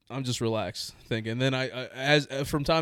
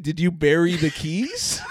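laughing disbelief; 6 semitones higher, 4 dB louder, slower than usual. Retold or imitated. Incredulous at mom's instructions.

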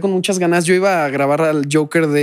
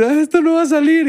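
despairing; 11 semitones higher than usual. Retold or imitated.

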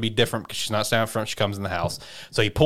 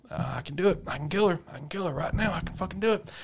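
soft, higher-pitched retold pep talk; nervous self-urging; 8 semitones higher, 7 dB quieter, faster than usual. Character talking himself into killing her, nervous. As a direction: psych yourself up under your breath.

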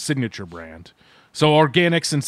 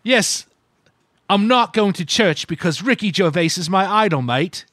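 higher, cheeky British lad voice; mock British accent; 5 semitones higher than usual. Host riffing about Ricky Gervais, mock British accent. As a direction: sell it like a proud Brit.

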